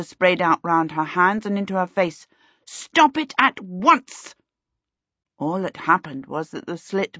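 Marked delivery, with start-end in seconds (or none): 2.6-4.3 firm command. A narrator's reading. Commanding the leaking pipe.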